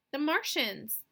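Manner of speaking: a rising panicked cry. Acted. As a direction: blurt it out in fright. Panicked announcement of Martians.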